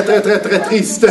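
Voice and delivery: ordinary talk, nothing marked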